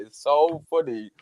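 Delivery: ordinary delivery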